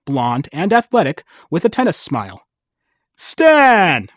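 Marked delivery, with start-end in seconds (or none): 3.2-4.1 bright. Acted. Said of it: "Vic said brightly".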